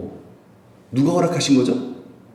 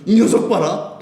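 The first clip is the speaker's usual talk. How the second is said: mock-stern parent; 7 semitones higher than usual. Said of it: "부모님".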